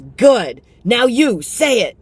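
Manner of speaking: high, loud approving mom voice; bossy cheerful mom; mom directing. Retold or imitated. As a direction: praise then push like a proud mother. Mom voiced, praising then urging.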